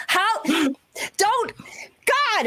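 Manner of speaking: high-pitched flustered exclamations; very upset. Acted. Flustered girl glaring at whoever leaked her secret, very upset. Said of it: "is very upset".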